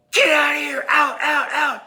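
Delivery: very high, loud frantic yell. Retold or imitated. Chasing his mom from the bathroom, high frantic yell. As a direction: shout her out of the room. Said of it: "just yell at her".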